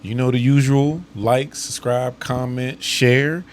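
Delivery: upbeat loud sign-off; podcast outro. Acted. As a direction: wrap up the show brightly.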